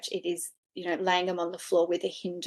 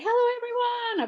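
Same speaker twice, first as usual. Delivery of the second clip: much higher, bright sing-song greeting to the room; cheery hello. Retold or imitated. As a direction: call out a happy hello like greeting friends. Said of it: "I say".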